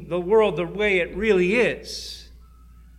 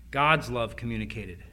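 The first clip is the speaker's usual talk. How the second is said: ordinary talk, nothing marked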